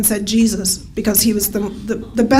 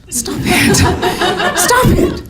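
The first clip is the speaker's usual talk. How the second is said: giggling, pitch climbing up; can't hold the laugh in while begging; laughing plea; 9 semitones higher, slower than usual. Retold or imitated. Begging her sister to stop while laughing herself. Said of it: "She's laughing".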